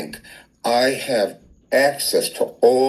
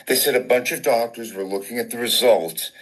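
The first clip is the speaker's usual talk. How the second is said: usual speaking voice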